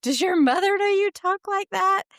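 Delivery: rising into a loud, high scolding shout; retold indignant yell. Woman scolding a stranger at a gas pump, rising to a loud high shout. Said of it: "And I said".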